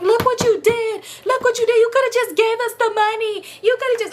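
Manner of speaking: high whiny mock voice, accusing; mock crying daughter. Daughter blaming narrator for mom's arrest, high whiny mock. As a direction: whine it up high like a caricature. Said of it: "The daughter is crying".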